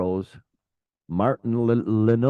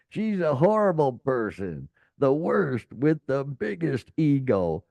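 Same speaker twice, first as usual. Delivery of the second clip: put-on southern accent; 6 semitones higher than usual. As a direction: read the quote in a light Southern accent, pitched up. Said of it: "I'm gonna use my southern accent".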